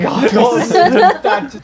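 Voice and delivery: high, loud yell breaking into laughter; laughing yell. Acted. Player adds Thatcher to the goblin alarm, laughing. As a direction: shout the alarm through laughter.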